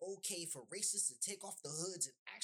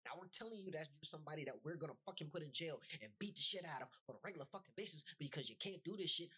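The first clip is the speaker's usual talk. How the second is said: normal talking voice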